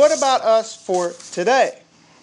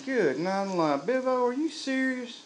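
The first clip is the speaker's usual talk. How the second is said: higher, softer, incredulous God; hushed disbelief from up in heaven, played for laughs; mock-appalled God looking down at a driver; comic voice of God; 6 semitones higher, 5 dB quieter than usual. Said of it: "God is up there going".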